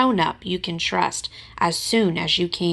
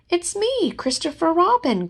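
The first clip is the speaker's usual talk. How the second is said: calling out; 11 semitones higher than usual. Acted. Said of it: "called Christopher Robin".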